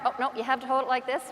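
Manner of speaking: ordinary delivery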